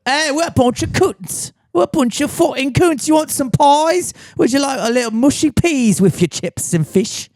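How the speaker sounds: loud, high, sneering cartoon Londoner; mock London accent. Mocking Tottenham fans to his co-host, hammed-up high loud cockney accent. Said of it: "That's what they sound like in Tottenham".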